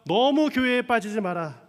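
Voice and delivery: retold mother's rebuke, loud and high; sharp retort. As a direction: fire it back as a scolding warning. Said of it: "다시 한번 쏘아붙였습니다".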